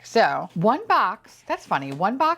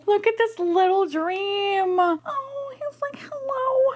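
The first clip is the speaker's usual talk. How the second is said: very high, drawn-out cooing; high-pitched cute-overload wail; besotted squeal; slower than usual. Real, not acted. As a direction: gush in a squeak, near tears with delight. Cooing at final toy.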